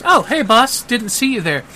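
high, chirpy surprise at the boss; bubbly lady. Acted. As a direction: sing out a cheery hello to the boss. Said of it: "And then she goes".

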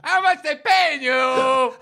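high, slow, hammed-up jeer at the ref; mock tunnel jeer. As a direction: blare it out like a PA taunt. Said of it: "Massive P A blurting out when he's going".

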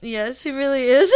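laughing through the words. Real, not acted. Laughing as she agrees about the cat.

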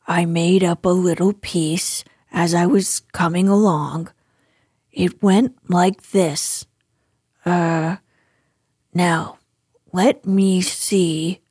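low-pitched bumbling bear, hesitant; modest, ponderous storybook bear; Pooh. Acted. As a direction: speak low, slowing as you search your memory.

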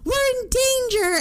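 a high, frightened cry; panicked. Retold or imitated. Retelling her frantic Facebook post, high alarmed cry. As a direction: squeal it in alarm.

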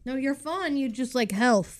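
talking while chewing; words round a bite; mouth full. Real, not acted. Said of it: "what are you eating over there".

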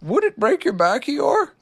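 a touch of surprise; surprised Pooh. A narrator's reading. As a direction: ask in mild surprise. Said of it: "asked Pooh, very much surprised".

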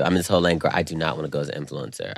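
normal talking voice

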